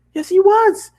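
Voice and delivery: very high, loud, firm pony voice; pony insisting to owner, very high; determined pony. Acted. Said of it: "she said with determination".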